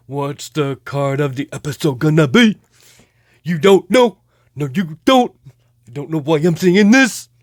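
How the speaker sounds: silly mock character asking the viewers; hammed-up silly voice; goofy put-on voice. Goofy voice mimicking an episode question.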